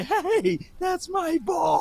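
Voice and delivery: high, drawn-out mimicking cry; Playmaker high-pitched voice. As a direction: claim it in a high imitation voice. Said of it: "reach into the playmaker".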